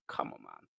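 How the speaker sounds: breathy under-the-breath "Come on, man"; exasperated aside. Real, not acted. Fed up with the injury news, muttered to himself. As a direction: sighs it out to himself, barely voiced.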